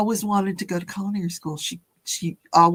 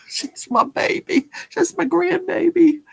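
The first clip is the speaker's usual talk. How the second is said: near tears; 10 semitones higher, 4 dB louder than usual. Real, not acted. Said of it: "Oh, don't make me cry".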